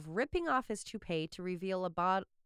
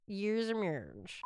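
slow careful repeat with a bitten tongue; slowly retry the words past a sore tongue; just bit tongue; 3 dB quieter than usual. Real, not acted. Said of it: "Ow, I bit my tongue".

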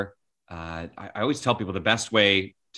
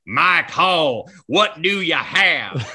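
louder, higher anchor impression, drawn out; Bill Pidto; 4 semitones higher, 6 dB louder, much slower than usual. Famous anchor greeting a younger colleague, big impression. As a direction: boom it out slowly like a famous anchor. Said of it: "What an impression of Pito".

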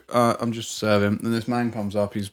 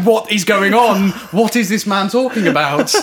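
mock bewilderment; 9 semitones higher, 8 dB louder than usual. Telling a friend about the customer, loud mock confusion. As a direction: sound utterly lost at what the man says. His confused inner reaction.

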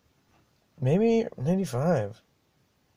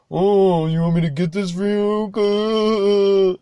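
higher, louder, slow mock-sweet sarcasm; sarcastic; 7 semitones higher, 5 dB louder, slower than usual. Driver mocks the passenger's offer, sarcastic and drawn out. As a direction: ham up the passenger's fake helpfulness. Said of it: "Like, sarcastically".

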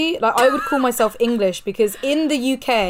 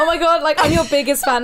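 mock gushing fan; 4 semitones higher than usual. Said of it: "Are we gonna go like".

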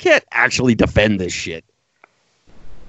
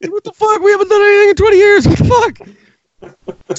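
much higher, louder and fast; mock outraged shout; 5 dB louder, faster than usual. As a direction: yell it like a fed-up victim. Voicing Iraq, outraged.

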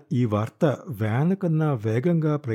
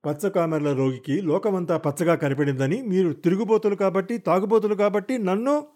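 furious insults; 6 semitones higher than usual. Acted. Wife hurls insults.

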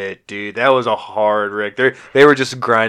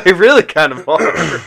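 laughing; 6 semitones higher, 6 dB louder, slower than usual. Real, not acted. Laughing agreement about racism.

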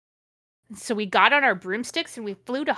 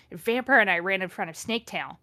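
usual speaking voice

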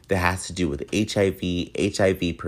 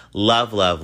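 "Love, love," thrown out louder with a lift; emphatic sign-off; 3 semitones higher, 4 dB louder than usual. Real, not acted. Host blowing love to listeners, punchy and bright. Sign-off repeated loving words.

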